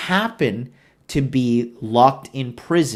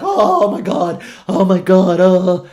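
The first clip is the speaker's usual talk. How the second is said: higher, louder hammed-up panic; mock terrified Finn; 5 semitones higher, 8 dB louder than usual. Mocking Finn falling down in fright, hammed-up high panic. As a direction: squeal it like a bumbling fool. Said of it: "looking like a bumbling fool".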